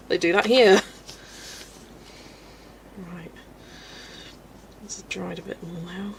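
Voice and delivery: normal talking voice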